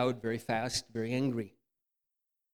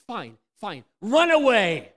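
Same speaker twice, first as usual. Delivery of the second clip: mocking dismissal; 8 semitones higher, 12 dB louder, slower than usual. Mocking pretend reply.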